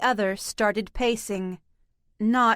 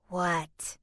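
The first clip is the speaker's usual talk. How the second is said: soft eerie cat voice; 4 semitones lower, 6 dB quieter than usual. A narrator's reading. Lone cat speaks softly.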